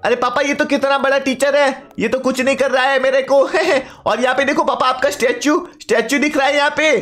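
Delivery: son's high, chatty voice; eager son. Acted. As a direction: play the excited kid calling out to papa.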